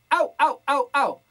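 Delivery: loud sealed bright au calls; compressed au. Shown as a demonstration. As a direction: seal the glottis and punch it. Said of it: "pueden hacer". (au comprimido y sellado)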